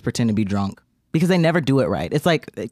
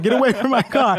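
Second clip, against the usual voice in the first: high laughing command; mock warning; 9 semitones higher than usual. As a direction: bark it through laughter. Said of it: "she's like".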